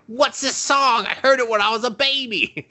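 high, excited cartoon girl voice; mock character voice. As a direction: play the clueless new girl bursting in. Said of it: "is just like".

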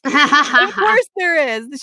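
hammed-up, slow exclamation through a laugh; emphatic reaction. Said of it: "I was like".

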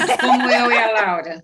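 laughing. Real, not acted. Teasing about accents, laughing.

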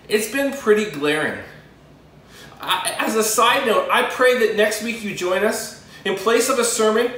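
ordinary talk

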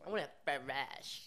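slow whiny mimicry; mocking whine. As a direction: drag it like a fussy customer. Mocking a fussy customer.

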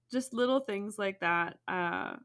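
ordinary talk

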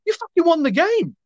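high-pitched incredulous outburst; exasperated retort. Retold or imitated. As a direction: squeal it in mock exasperation at gloating fans. Acted exasperated retort to trolling fans.

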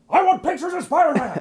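high, far-off barking editor voice; barking newspaper editor. Retold or imitated. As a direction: bark it like a furious newspaper boss. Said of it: "and he goes".

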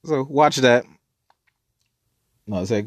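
ordinary delivery

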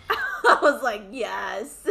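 laughing loudly through the words. Real, not acted. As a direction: crack up while telling it. Laughing retelling teacher embarrassment.

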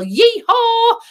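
very high, loud cowboy whoop; exuberant yell. Acted. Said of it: "and we yell".

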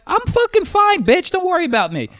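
very high, squawked comeback; mock-furious protest at the top of the range; retold angry outburst. Retold shouting match with the driver.

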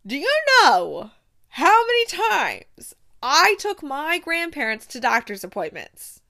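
rising disbelief, almost pleading; exasperated rant. Real, not acted. Reviewer ranting to viewers about no hot doctors, loud exasperated disbelief.

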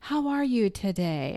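little boy talking like an adult, lightly voiced; a touch of polite grown-up small talk; imitating a grown-up greeting. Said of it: "greets you as if you're an adult".

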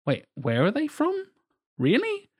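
high incredulous questioning, rising sharply on "Really?"; mock disbelief. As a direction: play a baffled viewer, voice shooting up. Voicing baffled viewers' disbelief.

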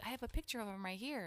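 lightly voiced eager grandmother; imitating a keen older lady. Retelling eager proud grandmother.

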